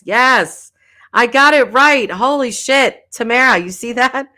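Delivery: loud, happy cheer at getting it right; excited. Real, not acted. Streamer thrilled at finally saying a name right, cheering loudly. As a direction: celebrate like you just won. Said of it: "I'm all excited".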